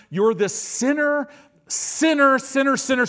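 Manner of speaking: high and loud, hammering sinner, sinner; mock accusation. Satan's voice hammering the believer, loud mocking accusation. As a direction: jab each sinner like an accusation.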